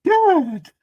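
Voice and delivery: high, loud mock shriek of Dad; imitating daughter. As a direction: ape the daughter's shocked call. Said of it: "she's like".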